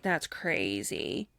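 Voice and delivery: quiet, slow, flat-out disbelief; deadpan aside. Retold or imitated. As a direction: mutter it slowly under your breath. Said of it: "I'm like".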